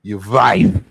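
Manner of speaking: "Your wife" with a hard v, "vife", said loud; spat like a cuss word. Retold or imitated. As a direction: bite out "Your wife" as if swearing. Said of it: "like it was a cuss word".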